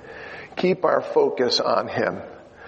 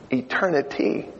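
ordinary delivery

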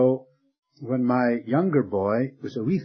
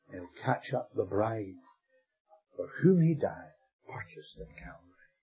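hymn quoted softly; 4 semitones lower, 5 dB quieter than usual. Retold or imitated. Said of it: "That little hymn says".

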